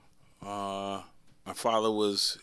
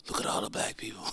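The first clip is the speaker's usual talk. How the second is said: hushed, breathy whisper from the back seat; childhood whisper; 4 dB quieter, faster than usual. Retold or imitated. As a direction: whisper it like a wide-eyed kid at the window. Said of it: "I used to look out the window and go".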